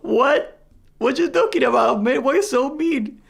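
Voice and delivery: high, bewildered whine; confused Australian player. Retold or imitated. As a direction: play the baffled guy, pitch up. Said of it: "the guy's like".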